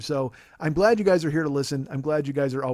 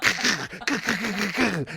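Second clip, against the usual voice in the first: cackling laugh; 6 semitones higher than usual. Real, not acted. Laughing between yeehaws.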